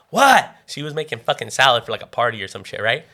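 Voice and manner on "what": high, loud imitation of mom's answer; irritated shout back. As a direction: call back as a bothered mother. Mom answers son's call.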